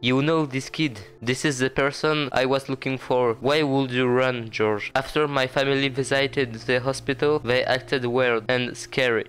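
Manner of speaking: put-on careful English dialogue reading in a French accent. Gamer reads George's rescue dialogue aloud, French-accented English. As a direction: read each line evenly, French accent throughout. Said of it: "Magnifique accent anglais".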